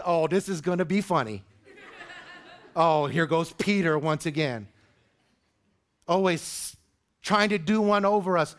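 mock Peter as the watching disciples would; skeptical disciples. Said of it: "I can just picture the other".

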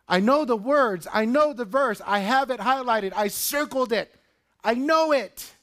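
high, loud, pleading outcry; anguished cry. Acted. Hurting believer crying out to his counsellor, anguished and loud. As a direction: cry it out like someone at the end of their rope.

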